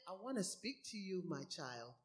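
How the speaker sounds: put-on God gently calling his crying child, soft and low; tender God voice. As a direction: speak it like a patient father to a child. God's gentle voice in sermon.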